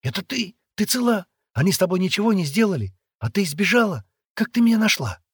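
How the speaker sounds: high, loud rush of questions; excited questioning. A narrator's reading. Boy finding his friend alive, excited.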